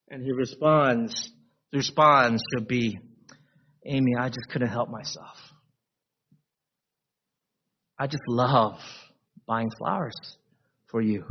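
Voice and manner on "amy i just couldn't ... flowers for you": soft, low, loving; tender reply. Retold or imitated. Husband answering his wife tenderly. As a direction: say it gently to her.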